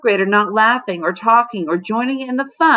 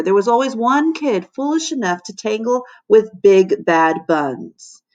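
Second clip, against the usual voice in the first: usual speaking voice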